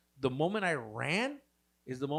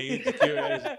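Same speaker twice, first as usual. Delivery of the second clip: mock taunting; 9 semitones higher, 8 dB louder than usual. Taunting the boyfriend.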